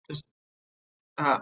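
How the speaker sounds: usual speaking voice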